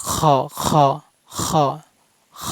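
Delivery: "খ" as a throaty fricative with rounded vowel; end-of-throat kho. Shown as a demonstration. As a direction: scrape air at the top of the throat into "খ". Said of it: "খ উচ্চারণ করবেন". ("খ" গলার শেষ অংশ থেকে)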